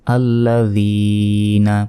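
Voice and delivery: আল্লাযীনা very slow, the "ee" before না held long and level; slow recitation demo of আল্লাযীনা with a long middle vowel; one-second elongation. Shown as a demonstration. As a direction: stretch যী in আল্লাযীনা, then land on না. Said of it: "এক second টেনে পড়তে হবে".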